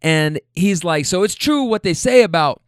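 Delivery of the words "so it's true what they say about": imitating a man getting louder, pitched up; raised voice. Man climbing out of the car mid-argument, raised voice. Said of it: "now his voice is getting raised".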